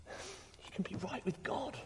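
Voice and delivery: soft whispered assurance; whispered promise. Real, not acted. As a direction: hush it like a secret hope. Tender climax of dying turn.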